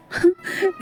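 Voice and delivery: a short amused laugh at the stranger; character laughing. Acted. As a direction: laugh at his odd words. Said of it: "मुझे हँसी आ रही है". (अजीब बातों पर हल्की हँसी)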